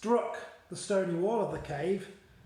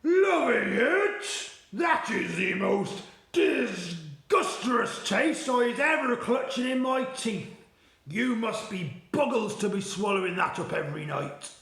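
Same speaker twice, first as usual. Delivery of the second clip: furious Blood Butler; 4 semitones higher, 6 dB louder than usual. Acted. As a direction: rage at the foul taste. Disgusted giant rages at BFG.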